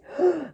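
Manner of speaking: sudden high, breath-heavy gasp; imitated guttural release. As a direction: act out a cry bursting from the gut. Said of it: "like, guttural".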